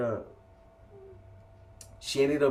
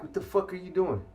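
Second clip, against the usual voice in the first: indignant question, pitched up without shouting; retold fired-up challenge; 6 semitones higher than usual. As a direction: replay confronting her, incredulous and higher. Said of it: "getting more fired up by the minute".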